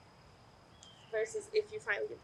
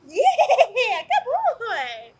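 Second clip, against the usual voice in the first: loud, high-pitched cheer for a dog; squealing, over-the-top praise; super exciting; 11 semitones higher, 13 dB louder, much slower than usual. Shown as a demonstration. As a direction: celebrate the dog at full volume. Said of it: "be super exciting".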